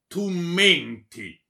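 loud, high, drawn-out shout; furious accusation. A narrator's reading. Baron calling the friar a liar, furious. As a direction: stretch the accusation out in outrage.

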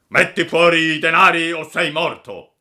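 loud, menacing killer voice; tall murderer. Acted. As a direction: threaten him with death. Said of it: "disse l'assassino più alto".

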